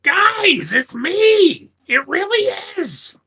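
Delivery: high goblin yelp and plea; hurt goblin. Acted. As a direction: goblin Splug hit by an arrow, pleading. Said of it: "I'm like".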